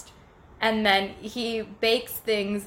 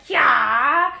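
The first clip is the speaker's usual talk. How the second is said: loud, higher yell swooping upward; Link's battle cry; 4 semitones higher, 11 dB louder than usual. Acted. Storyteller voicing Link answering Ganondorf, loud rising battle yell. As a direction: shout it back like a tiny game hero attacking. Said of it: "And he was like".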